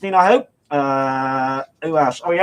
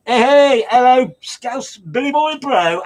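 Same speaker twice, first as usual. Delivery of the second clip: excited greeting; 8 semitones higher, 4 dB louder, slower than usual. Real, not acted. DJ greets a listener on air.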